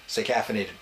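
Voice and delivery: ordinary delivery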